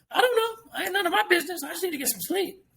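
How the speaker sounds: high, whiny play-innocent whimper; mock innocent high voice. Plays innocent, head in sand.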